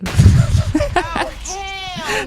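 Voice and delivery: a sudden high cackle. Real, not acted. Host cracks up at the clip, big laugh.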